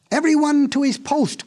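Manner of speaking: high-pitched marshalling call; crying out. A narrator's reading. Monkey sending the animals to their posts, calling out. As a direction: call everyone into place. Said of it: "cried the monkey".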